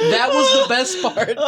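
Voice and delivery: high, laughing through tears. Real, not acted. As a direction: force words out through laughter. Laughing fit mid reading.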